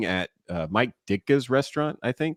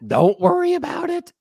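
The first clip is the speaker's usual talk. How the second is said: squeaky mock reassurance; 7 dB louder than usual. Mock reassurance after lying.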